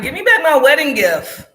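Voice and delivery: half-joking indignant outburst; mock demand. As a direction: playfully demand the present back. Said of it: "No, I'm just playing".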